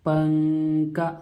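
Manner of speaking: पंका drawn out very slowly, nasal n; slow motion. Shown as a demonstration. Teacher repeats the word for students, very slow. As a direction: hum the n of पंका through the nose, slowly. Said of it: "slow motion में बोलिए".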